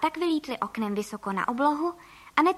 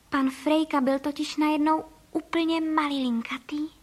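plain reading voice

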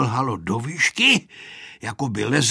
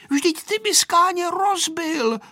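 high, drawn-out scolding; cross, slow, high-pitched telling-off; cross little girl. A narrator's reading. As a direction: scold him in a high child's voice. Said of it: "zlobila se Aneška".

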